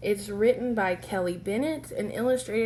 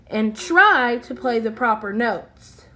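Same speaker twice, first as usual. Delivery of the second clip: stern teacher; 9 dB louder than usual. Acted. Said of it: "snaps Maestro".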